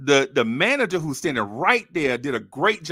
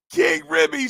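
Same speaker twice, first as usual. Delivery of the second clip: retold yell; 11 semitones higher, 4 dB louder than usual. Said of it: "she yelled out in a full restaurant".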